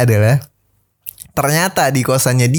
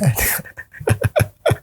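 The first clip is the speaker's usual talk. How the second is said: breathy high laugh; 5 dB quieter than usual. Real, not acted. Laughs at his own story.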